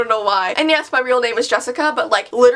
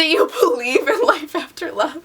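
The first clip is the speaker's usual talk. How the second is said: laughing. Real, not acted. Reads lyric tweet, laughing.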